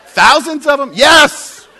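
high, full-volume exasperated shout; getting madder. Retold or imitated. Tenant insisting to disbelieving landlord, furious. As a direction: yell it in acted fury. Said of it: "So I'm getting madder and madder".

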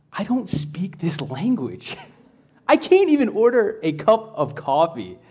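mock-helpless plea, pitch climbing as it goes; helpless complaint. Traveler stuck at a coffee counter without the language, helpless complaint. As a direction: act out comic exasperation, stretching it out.

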